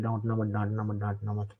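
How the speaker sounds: soft, whiny mimic of a timid student; hushed mock plea, sing-song rise; mocking whine. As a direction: repeat it like a scared kid begging. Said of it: "बेवकूफ की तरह".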